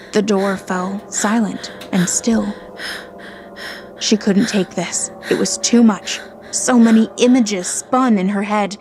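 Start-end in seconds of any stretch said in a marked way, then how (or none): none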